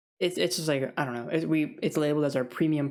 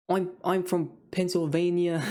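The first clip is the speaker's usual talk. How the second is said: slow, higher, badly faked Pennsylvania accent; muddled Pennsylvania accent; 4 semitones higher than usual. Customer introducing themselves at the deli, muddled Pennsylvania accent. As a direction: attempt a Pennsylvania accent and miss. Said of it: "I don't know what accent I'm even trying".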